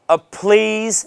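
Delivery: demonstrated needy plea; desperate, needy. Shown as a demonstration. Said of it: "then I'm sounding desperate or needy".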